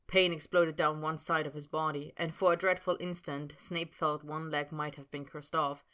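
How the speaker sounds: ordinary delivery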